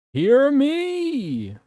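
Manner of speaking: high, loud call out to the audience. Real, not acted. Streamer calling out to check chat can hear. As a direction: shout it up to the whole room.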